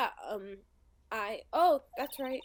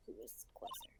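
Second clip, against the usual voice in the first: whispering; 14 dB quieter than usual. Acted. Whispered aside.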